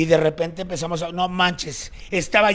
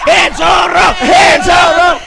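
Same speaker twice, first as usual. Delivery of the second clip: very high, loud chant; rallying shout; 12 semitones higher, 11 dB louder, much slower than usual. Real, not acted. Host cheering after a joke lands, rallying shout. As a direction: belt it out to the whole room.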